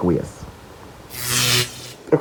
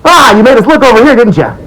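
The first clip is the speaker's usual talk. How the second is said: mocking sing-song; 12 semitones higher, 4 dB louder than usual. Mocking the distraction trick.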